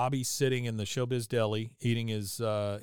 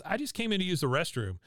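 put-on higher boyish voice; a kid caught out, voice pitched up; Bobby fib; 6 semitones higher than usual. Bobby lying to Connie in the deli, higher boyish voice.

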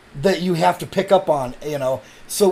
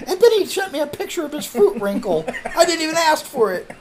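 much higher, laughing mock outrage; mock outraged; 12 semitones higher than usual. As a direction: squeal it like a scandalized friend. Mock offended retelling.

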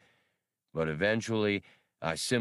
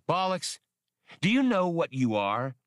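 higher, louder, challenging demand; mad; 9 semitones higher, 3 dB louder than usual. A narrator's reading. Han angrily confronting the junk heap droid, higher and louder. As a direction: put the droid in his place. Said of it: "mad without knowing exactly why".

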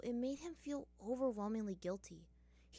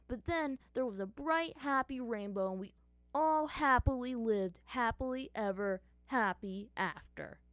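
louder, higher, mock-cheerful storytelling; fake excitement; 4 semitones higher, 6 dB louder than usual. Sokka mocking his own capture with fake cheer. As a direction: oversell the happy ending sarcastically. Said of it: "his voice dripping with fake excitement".

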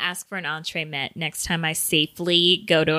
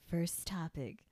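breathy mock-sexy whisper; 4 semitones lower, 13 dB quieter than usual. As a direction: whisper it like a seduction. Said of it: "Are you trying to be, like, sexy".

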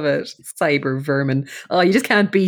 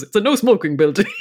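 angel voice; 2 semitones higher than usual. Retold or imitated. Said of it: "angel voice goes".

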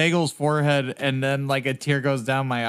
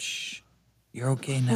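soft bedside voice; 2 semitones lower, 6 dB quieter than usual. Acted. Comforting dying friend at bedside.

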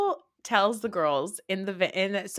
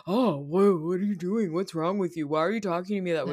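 lightly mocked offended victim, opening on a gasp; mock-aghast string of complaints; imitating wounded indignation; 3 semitones lower than usual. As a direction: act hurt and taken aback. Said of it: "Aaron is like".

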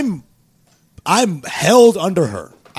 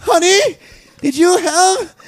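mom voice; slower than usual. Retold or imitated. Voicing his mom on the phone.

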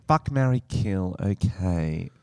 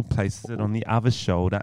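usual speaking voice

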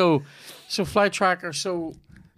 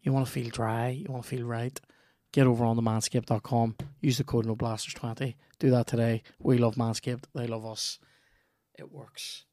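fading soft ad read; 5 dB quieter than usual. Acted.

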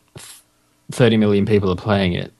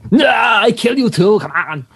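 put-on Swedish accent; 11 semitones higher, 3 dB louder than usual. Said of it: "Who ki".

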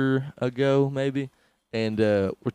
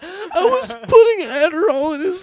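high, louder, laughing through it; 11 dB louder than usual. Retold or imitated. Riffing on the crying mom while laughing. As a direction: squeal the words while cracking up.

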